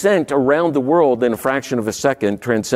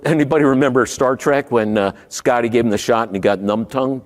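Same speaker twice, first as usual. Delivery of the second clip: ordinary talk, nothing marked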